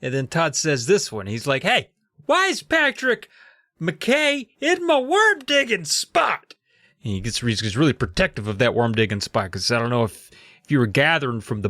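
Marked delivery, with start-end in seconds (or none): 1.6-6.5 high, slowed indignant whine; outraged kid spotting an intruder. Retold or imitated. Todd voiced in retelling.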